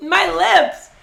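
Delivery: high loud laughing yelp; pained yelp. Real, not acted. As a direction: cry out at burning lips. Lips burning from sauce.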